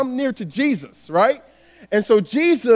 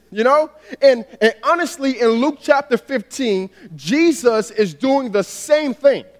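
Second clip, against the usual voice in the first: normal talking voice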